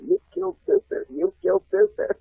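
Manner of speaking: very high childish mock scream; kids screaming. Kids accusing him in class, a very high mock scream. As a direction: shriek it like a horrified schoolkid. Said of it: "all the kids screaming".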